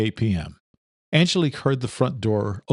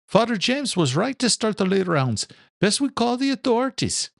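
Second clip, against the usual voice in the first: put-on higher young man's voice, earnest; young groundskeeper, dutiful and higher; young son; 12 semitones higher than usual. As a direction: son answering his father, pitched higher. Son's voice in story.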